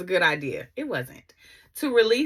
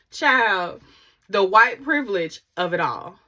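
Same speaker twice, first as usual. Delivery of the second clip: ordinary talk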